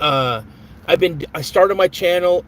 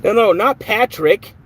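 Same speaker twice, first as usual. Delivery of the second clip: dismayed protest; 6 semitones higher, 5 dB louder than usual. Real, not acted. Troll ban hit the wrong viewer.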